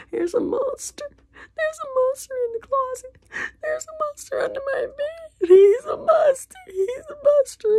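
put-on high crying child voice; frightened child crying. Scared child crying about the monster. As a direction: wail it like a scared little kid.

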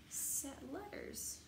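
ordinary delivery

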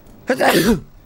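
a harsh loud cough. Real, not acted. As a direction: cough hard. Coughs, then says sorry.

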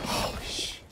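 hushed, voiceless gasp of words; awed whisper. Real, not acted. Viewer stunned by the move, awed whisper. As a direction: whisper it under your breath, cut short.

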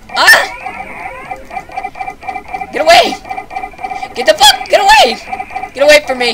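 high, loud panicked yells at the monsters; panicked screaming. Real, not acted. Gamer fleeing monsters in the game, panicked screaming. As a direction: shriek it out, terrified of the creatures.

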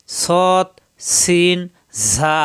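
সৎ, সিন, ঝা each opened with a whistled hiss; whistling sibilants. Shown as a demonstration. Teacher showing how these letters are articulated, whistling sibilants. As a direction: let air stream out on সৎ, সিন, ঝা. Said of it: "শীষ দিয়ে উচ্চারণ করতে হয়".

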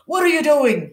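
storybook policeman's stern question; demanding policeman. A narrator's reading. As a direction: demand an explanation sharply. Policeman grabs boy, demands answer.

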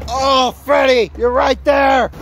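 high, loud, drawn-out holler; encouraging shout. Real, not acted. Watcher calling to Freddy nearly landing it, encouraging shout. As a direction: shout it out to the skater. Cheering on a skater's attempt.

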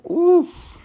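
very high, loud admiring oof; impressed oof. Real, not acted. Vlogger gasping at the sneakers, a high loud oof. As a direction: let out an awed oof.

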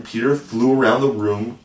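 plain reading voice

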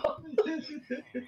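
soft trailing laugh; laughter. Real, not acted. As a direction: laugh it off lightly. Laughing at the school question.

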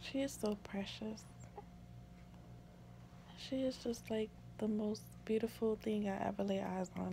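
very soft, hushed adoration; tender awe. Real, not acted. New mother describing her precious baby, hushed and tender. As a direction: murmur it in loving wonder.

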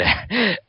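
high gloating cackle. Acted. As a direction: cackle with cruel glee. Villain laughs while grabbing.

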